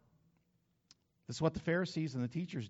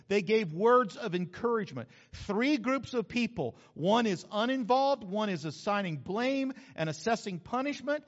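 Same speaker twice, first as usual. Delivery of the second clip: full-force preaching; 4 semitones higher, 6 dB louder than usual. Acted. Preaching to congregation.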